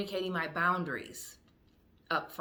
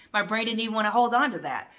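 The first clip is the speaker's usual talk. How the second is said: loud, higher-pitched amused exclamation; laughing outburst; 5 semitones higher, 10 dB louder than usual. Real, not acted. Laughing to viewers about a lost train of thought, loud. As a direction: burst out loudly, laughing at yourself.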